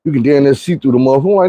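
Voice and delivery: normal talking voice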